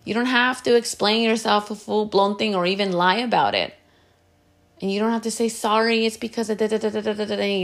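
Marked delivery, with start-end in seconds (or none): 5.5-7.6 mock long-winded apology, raised pitch; rambling excuse. Giving people a long excuse for saying no, rambling. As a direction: run on with filler syllables, imitating endless justifying. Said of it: "and it's, like, super long".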